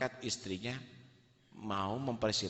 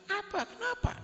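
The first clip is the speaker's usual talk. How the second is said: alarmed question. Acted. Husband alarmed by the scream.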